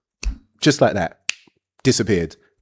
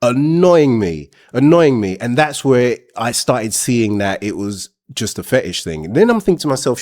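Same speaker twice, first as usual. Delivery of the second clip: ordinary delivery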